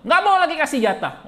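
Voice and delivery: high, loud joking punchline; hammed-up sulky-wife list; comic punchline. Listing wife's sulking acts. (lelucon dengan suara tinggi)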